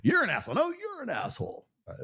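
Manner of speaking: hammed-up debater trading insults; mock bickering insults. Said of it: "Like, yeah".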